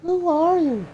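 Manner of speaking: put-on high startled girl voice; high young woman voice. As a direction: ask it high and alarmed.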